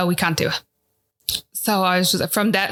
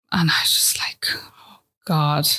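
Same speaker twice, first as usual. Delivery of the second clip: low, breathy near-whisper of despair; tearful hush; 4 semitones lower, slower than usual. Real, not acted. Mother telling a friend about the scan, tearful and hushed. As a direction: barely get the words out through tears.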